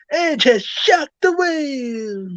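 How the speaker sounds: over-the-top commentator cry, very high; Joey Styles. Retold or imitated. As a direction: stretch it into a wailing, hammed-up call. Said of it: "he said it over the top".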